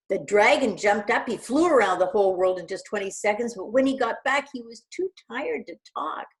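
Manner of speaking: plain reading voice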